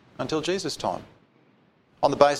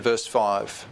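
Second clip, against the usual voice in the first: ordinary talk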